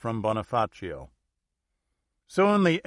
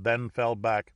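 usual speaking voice

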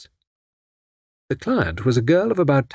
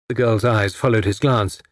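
ordinary delivery

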